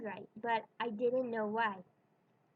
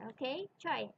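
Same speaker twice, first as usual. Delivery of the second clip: usual speaking voice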